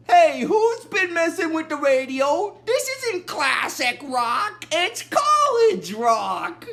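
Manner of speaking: loud, high mock outrage; hammed-up indignant yelling; annoyed. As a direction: act out an irritated complaint, building to a drawn-out shout. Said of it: "Bender gets annoyed like that".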